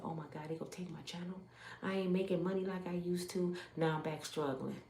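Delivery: soft, fast panicked worry; panicked hush. Retold or imitated. Woman watching her YouTube income drop, hushed panic. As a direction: fret under your breath. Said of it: "I said".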